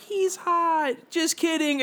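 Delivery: high-pitched mock girl voice; teasing schoolgirl. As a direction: imitate a girl teasing down the phone. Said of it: "phone calls from girls in my class".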